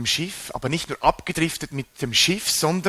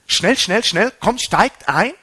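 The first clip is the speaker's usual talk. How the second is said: pressing them on in raised, higher voice; louder, higher-pitched urging; urgent hurrying; 6 semitones higher, 6 dB louder than usual. Acted. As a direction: hurry them along, loud and insistent. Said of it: "er drängt sie".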